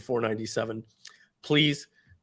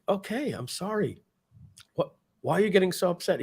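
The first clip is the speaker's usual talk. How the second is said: apologetic brother; 6 semitones higher, 3 dB quieter than usual. Retold or imitated. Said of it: "And he's like".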